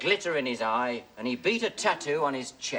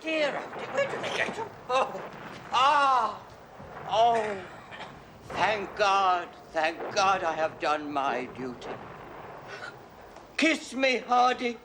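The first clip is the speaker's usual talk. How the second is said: milk the death scene; dying words; 2 semitones higher than usual. Acted. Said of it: "this is my dying scene".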